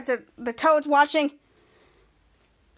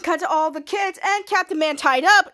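ordinary talk, nothing marked

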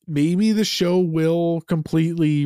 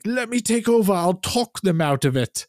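mocking quote; 8 semitones higher than usual. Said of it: "and says, like".

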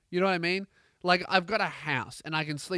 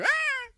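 very high drawn-out squeal; high mock animal cry; imitated yelp; 4 dB louder than usual. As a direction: yelp like a flung dog. Imitates dog flung out window.

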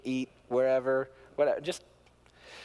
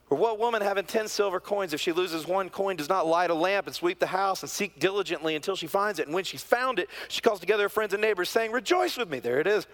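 raised, lively reading of the parable; scripture reading; 7 semitones higher, 4 dB louder than usual. Acted. Preacher reading the parable to the congregation, lively and raised. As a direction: read the coin parable with rising joy.

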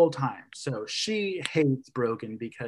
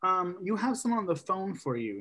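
much higher, prissy mother voice; hammed-up doting mother, pitched up high; posh mom voice; 6 semitones higher, 4 dB quieter than usual. Mom calls her son to the phone, high and prissy. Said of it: "the mom comes upstairs and he's like".

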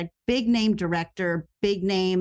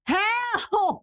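high yelled call to another room; retold yell for help; 12 semitones higher than usual. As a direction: call for help across the house. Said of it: "I yelled to my partner".

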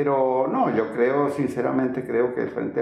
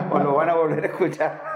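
laughing; 5 semitones higher than usual. Real, not acted. Joking podcast sign-off.

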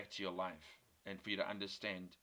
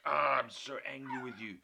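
retold angry scolding; 4 semitones higher, 5 dB louder than usual. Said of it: "And I, like".

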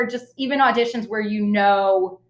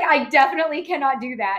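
quick, pitched-up protest of panic; panicked refusal; 6 semitones higher, faster than usual. Retold or imitated. Retelling the audition, protesting to the director in a quick rush. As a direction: blurt it out in a rush. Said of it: "And I was like".

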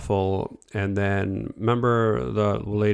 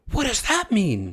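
baffled friend; 11 semitones higher than usual. Retold or imitated.

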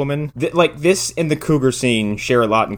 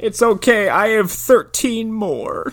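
high, slow fake-weepy voice; hammed-up sniffly reassurance, pitched up; mock tearful; 9 semitones higher, slower than usual. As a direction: pretend to recover through tears. Mock tearful cat lady.

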